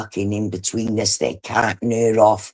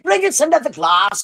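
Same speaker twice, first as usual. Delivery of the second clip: mock shout; 13 semitones higher than usual. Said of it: "she's shouting and fucking all loud".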